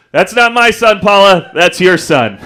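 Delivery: mock indignant husband firing back; dad's shouted retort. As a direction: throw the blame back loudly. Dad shouts back at mom.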